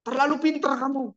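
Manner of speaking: angry father. Acted. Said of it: "Papanya marah dengan Francis".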